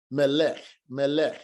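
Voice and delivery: melech with the final kaf shiwa silent; teacher drilling melech. Shown as a demonstration. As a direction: repeat melech cleanly, two syllables. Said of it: "telling you to pronounce it melech".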